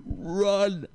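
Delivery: high shouted warning; urgent shout. Retold or imitated. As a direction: yell it across the street. Homeless man yells at him.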